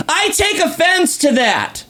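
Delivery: high, slow put-on child voice; offended little kid. Imitating a six-year-old taking offence, high and indignant. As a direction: mimic an indignant small boy, drawn out.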